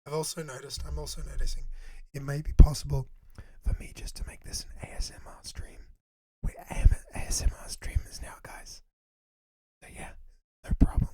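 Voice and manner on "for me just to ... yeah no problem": unvoiced whisper to spare a sore throat. Real, not acted. Joking to chat about becoming ASMR streamers, whispered. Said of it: "If I just whisper".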